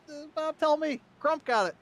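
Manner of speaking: high, loud, excited shouting; breathless-with-excitement race call; thrilled photo-finish call. Real, not acted. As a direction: cry out at a too-close finish. Photo finish for second place.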